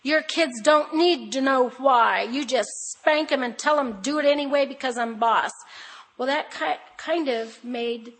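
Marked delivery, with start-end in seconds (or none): 0.0-5.5 mock the blunt advice we were given; stern, preachy quote; quoted stern advice. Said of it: "my husband and I have heard many times".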